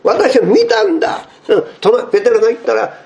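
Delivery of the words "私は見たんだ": high, eager insisting voice; excited disciples. Retold or imitated. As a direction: act out the disciples pressing Thomas. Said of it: "口々に".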